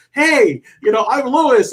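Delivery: put-on high, loud eager kid voice; hammed-up eager kid; excited kid. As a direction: bounce in like a little brother. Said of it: "He's like".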